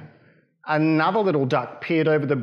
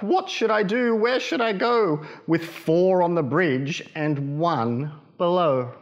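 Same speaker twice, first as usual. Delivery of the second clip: Mother Duck; 3 semitones higher than usual. Acted. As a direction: fret like a flustered mother duck. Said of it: "quacked Mother Duck".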